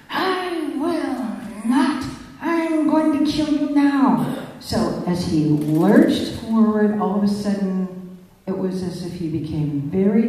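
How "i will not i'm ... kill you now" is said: high, very slow, drawn-out mock scream with a laugh in it; retold scream. Angry man lunging at the speaker, high raging scream. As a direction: stretch out the final threat in a frenzy. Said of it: "he screamed out".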